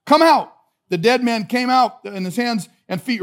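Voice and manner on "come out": loud, raised call with falling ends. Acted. Jesus calling the dead Lazarus from the tomb, loud voice. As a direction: call it out to summon someone. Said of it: "called in a loud voice".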